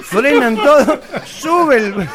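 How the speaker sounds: ordinary talk, nothing marked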